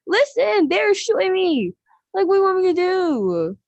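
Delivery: high, pleading gamer whine; a teen defending the yelling during a game, high and exasperated; exasperated protest. Retold or imitated. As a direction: argue back with mom mid-match, exasperated. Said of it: "I'm like".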